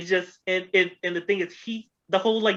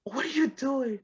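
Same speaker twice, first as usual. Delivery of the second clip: Super Dave scolding; 10 semitones higher, 4 dB quieter than usual. Retold or imitated. Super Dave Osborne berating Norm about his tiny show, high and exasperated.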